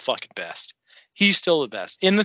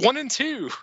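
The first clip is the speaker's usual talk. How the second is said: much higher, emphatic declaration; excited ranking; 10 semitones higher than usual. Retold or imitated. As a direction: announce it like it's obvious. His imagined ranking.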